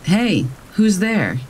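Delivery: mild shout. A narrator's reading. Camper calls to unseen whisperers outside the tent, mild shout. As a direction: call out slowly with restrained force. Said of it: "So I shout".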